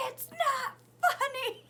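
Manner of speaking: put-on high, loud, drawn-out child protest; mock freak-out. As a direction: imitate an outraged kid shrieking slowly. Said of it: "he's, like, freaking out".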